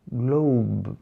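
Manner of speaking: ordinary delivery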